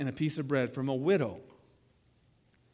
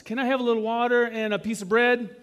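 eager request; 5 semitones higher, 5 dB louder than usual. Retold or imitated. Preacher voicing Elijah's bold request.

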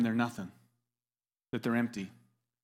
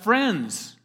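mild raised call; crying out; 4 semitones higher, 3 dB louder than usual. Acted. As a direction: call out a higher-pitched greeting. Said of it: "He cries out".

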